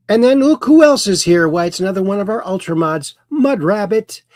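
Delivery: high, animated welcome of a newcomer; playful surprise. Pretend. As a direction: announce an arriving regular with showy surprise. Host greets another arriving moderator.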